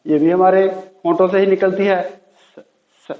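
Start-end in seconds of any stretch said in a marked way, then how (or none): 2.1-2.6 स as a long hissed s with no voice; unvoiced s. Shown as a demonstration. Teacher showing the voiceless स sound to learners. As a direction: hiss out स on breath alone. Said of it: "स sound".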